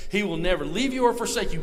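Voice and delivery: ordinary delivery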